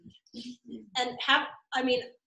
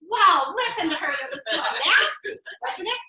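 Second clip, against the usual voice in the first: mock snide reading; 5 semitones higher than usual. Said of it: "in the tone of voice that I thought they had".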